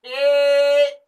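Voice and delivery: ए held long and loud; pluta e. Shown as a demonstration. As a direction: sustain ए like a call. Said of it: "क्या ऋषियों ने बताया". (ए का लंबा प्लुत उच्चारण)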